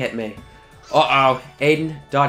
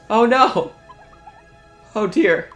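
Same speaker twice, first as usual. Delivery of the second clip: mock alarm; 10 semitones higher, 6 dB louder, slower than usual. Voicing party's alarm.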